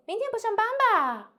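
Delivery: wrong high take. Shown as a demonstration. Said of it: "We cannot say".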